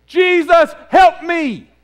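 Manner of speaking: crying out loud and high for help; desperate cry. Retold or imitated. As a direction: shout it like a drowning man begging. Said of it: "he said".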